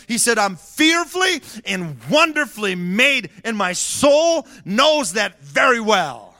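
high, loud preaching shout; full-force preaching. Acted. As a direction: preach it out, building to a roar.

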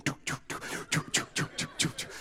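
soft voiceless "tuk" repeats; imitated feet bumping. Boy's feet dragging behind the bike, voiceless tuks. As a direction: tick out the bouncing feet in whispered clicks. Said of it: "my feet are just going".

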